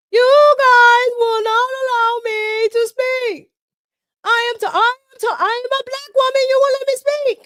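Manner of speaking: high, loud mock yell, rushed in the middle; mocking a shouting congresswoman. Vlogger imitating Ayanna Pressley demanding to speak, high loud mock yell. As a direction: screech it like an outraged politician. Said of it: "doesn't she sound like that?".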